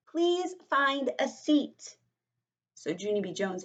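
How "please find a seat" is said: high, slow, deliberate call; drawn-out, raised classroom order; teacher's command. Acted. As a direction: call out firmly to a whole class. Teacher calls the class to sit.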